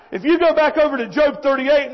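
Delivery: ordinary talk, nothing marked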